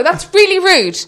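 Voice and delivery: loud, high scolding; retold reprimand with laughter creeping in; indignant telling-off. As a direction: tell them off like a cross parent. Retelling telling off the two men.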